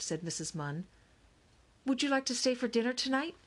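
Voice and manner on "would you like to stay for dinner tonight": high, quick, bright invitation; brightly. A narrator's reading. Mom invites the neighbors to dinner, brightly. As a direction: ask it brightly, pitched up and quick. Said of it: "Missus Smithfork said brightly".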